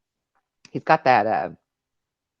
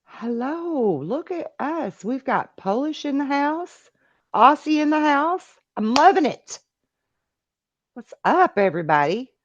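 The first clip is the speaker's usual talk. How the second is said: high sing-song welcome; excited greeting; 12 semitones higher than usual. Real, not acted. Welcoming Polish and Aussie viewers to the house, excited. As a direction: hail the whole house, pitched way up. Hailing the viewers from many places.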